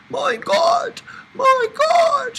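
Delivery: high shrieking panic; squealed. A narrator's reading. Dika panicking as the van passes a car, shrieking. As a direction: squeal it out in a fright. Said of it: "she squealed".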